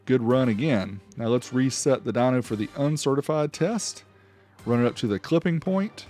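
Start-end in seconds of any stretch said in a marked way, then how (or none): none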